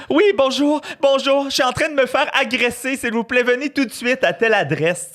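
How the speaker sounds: hammed-up call to the police; fake distress call. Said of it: "puis dire". (faux appel de détresse, voix aiguë et forte)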